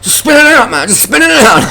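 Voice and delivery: squeaky high mock of a know-it-all rider; mocking high whiny voice. Mocking the rider from Norton Summit in a squeaky high voice. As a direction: pitch it way up like a smug cyclist. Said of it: "He goes".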